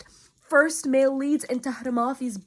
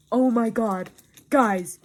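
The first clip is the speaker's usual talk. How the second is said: normal talking voice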